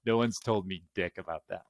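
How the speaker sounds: usual speaking voice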